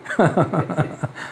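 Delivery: soft staccato chuckle; a quiet run of ha-ha laughter; real chuckle. Real, not acted. Preacher amused that every test came back normal, soft chuckle.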